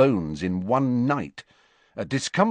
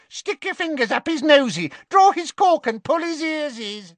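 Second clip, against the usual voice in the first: much higher, louder sing-song squeal; squealing Peeves; 4 dB louder than usual. Acted. Peeves swooping round the lamp, squealing a taunt. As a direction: chant it like a nasty rhyme.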